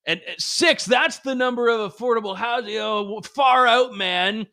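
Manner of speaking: lightly voiced lazy stoner; a hint of a dazed stoner. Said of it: "do it in, like, a, a stoner voice".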